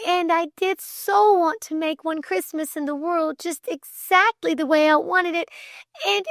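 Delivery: high, distraught confession with faltering restarts; high-pitched halting wail of remorse; tearful struggle. A narrator's reading. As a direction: pour out an apology on the edge of tears. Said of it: "Great tears welled suddenly".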